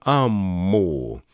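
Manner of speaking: "ammuu" drawn out very slowly like a cow; cow moo imitation. Shown as a demonstration. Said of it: "se ääni, mikä ammumisesta kuuluu".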